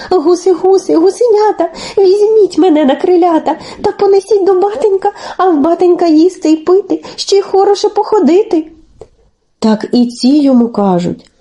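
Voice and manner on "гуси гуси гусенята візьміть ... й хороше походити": put-on high, quick child-like chant; pleading. A narrator's reading. Telesyk begs the geese to carry him home, high pleading. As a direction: beg in a small high voice. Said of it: "Телесик і просить".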